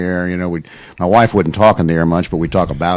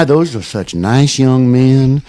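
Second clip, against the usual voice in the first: put-on slow, higher and louder Southern grandma accent; grandma's Alabama accent; 6 semitones higher, 5 dB louder, much slower than usual. Grandson imitating grandma praising the Peyton boys, Alabama accent. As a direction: croon it like a doting Alabama grandma.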